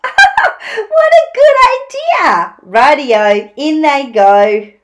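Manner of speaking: high, loud whoop of delight settling into a slow call; laugh, then cheer the idea on; hammed-up delighted dingo. A narrator's reading. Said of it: "Dingo laughed".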